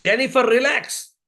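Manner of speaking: lightly acted mother urging calm; retold mother's order. Mother to crying baby in supermarket, mildly insistent. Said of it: "So the mother said".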